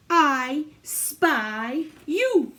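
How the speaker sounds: very slow, drawn-out frog voice. Acted. The frog speaking to the children, very slow and playful. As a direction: stretch each word out like a playful frog. Frog's line read aloud.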